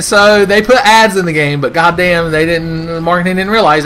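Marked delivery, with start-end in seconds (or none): none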